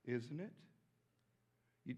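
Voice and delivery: ordinary talk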